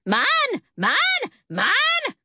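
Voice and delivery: high-pitched, possessive bird screech; screeching parrot. A narrator's reading. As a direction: squawk each word like a greedy parrot. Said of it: "he screeched".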